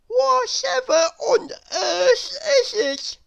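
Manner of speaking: high, groggy character grumble; sleepy complaint in a squeaky voice; grumbling Mister Nonsense. A narrator's reading. Mister Nonsense woken up, grumbling. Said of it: "grumbled Mister Nonsense".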